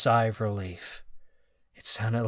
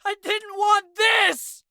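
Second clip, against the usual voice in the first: much higher, louder slow wail; 8 dB louder, slower than usual. Acted. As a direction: wail it in despair. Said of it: "Marcus wailed".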